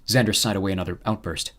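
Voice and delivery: usual speaking voice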